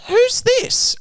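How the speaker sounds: put-on very high, thin old-lady question; old woman voice. Imitating the old caller asking who this is, very high. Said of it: "And she goes".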